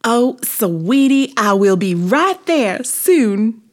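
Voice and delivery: high, loud, drawn-out figure voice; Busy Bee. A narrator's reading. Busy bee breaks off a phone call, high drawn-out voice. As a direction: gush it slowly to a sweetie. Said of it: "said Busy Bee, interrupting herself on the phone".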